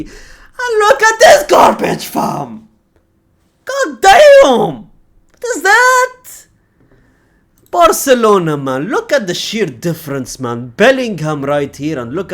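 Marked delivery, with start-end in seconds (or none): 0.0-6.5 very high, louder fake wail; mock wailing disgust. Real, not acted. Fan mocking Madrid lineup, high fake wail.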